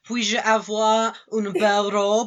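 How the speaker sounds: put-on slow French with a foreign accent; laboured, heavily accented French line. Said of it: "French was very heavily accented".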